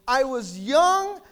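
loud, slow, drawn-out preaching; declaimed scripture. Real, not acted. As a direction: stretch each phrase out at full volume.

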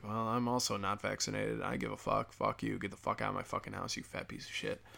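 muttered retort. Retold or imitated. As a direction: hiss the comeback under his breath. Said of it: "I was like".

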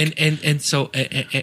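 neurotic stutter. Shown as a demonstration. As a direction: stammer haltingly, stuck on little words. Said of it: "You gotta stutter a lot".

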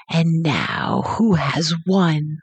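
low, slow, trembling witch; quivering witch voice. Acted. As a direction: let the witch's triumph quiver slowly. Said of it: "said in a quivering voice".

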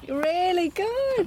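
high, slow, delighted; excited praise. Real, not acted. Praising a friend after his horse ride, high delighted. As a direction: stretch out the praise, thrilled.

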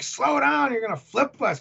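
yelping at his sister to ease off the oars; alarmed plea. Retold or imitated. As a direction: warn her before the dinghy flips. Panicked plea to sister in dinghy.

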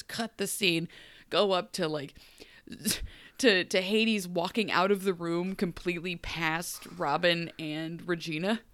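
ordinary talk, nothing marked

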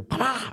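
sharp imitated bow snap; loud bow shot noise. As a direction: spit out a punchy pah. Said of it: "I pull the trigger".

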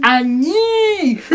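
overdone French "Année", ending long; exaggerated French pronunciation. Shown as a demonstration. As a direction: stretch the end of "Année" right up. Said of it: "do you say the E".

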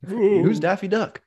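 high, slow, baffled voice; a lightly put-on confused voice asking who; mock clueless Brandon. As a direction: play the clueless old man asking. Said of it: "Brandon's gonna be like".

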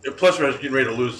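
normal talking voice

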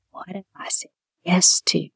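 hushed, whispered aside to oneself; whispered thought. Retold or imitated. Retelling an overwhelmed private thought.

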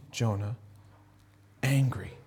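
low hushed whisper on angry; a hushed dramatic whisper. Shown as a demonstration. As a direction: breathe the word angry out. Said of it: "whispered for effect".

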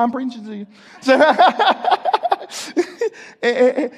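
laughing through the words. Real, not acted. Teasing the congregation, laughing at himself. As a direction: break into a real laugh at the joke.

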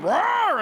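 high, loud possessed roar; a sudden beastly roar; demonic roar. Retold or imitated. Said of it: "she went".

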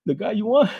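put-on high, eager little-kid voice; lightly voiced young snitch. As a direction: blurt it out like a helpful child. Kid voice answering police.

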